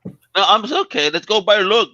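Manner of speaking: fine, have it your way, loud and high; exasperated concession. Real, not acted. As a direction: cohost concedes the argument, exasperated. Conceding a heated argument.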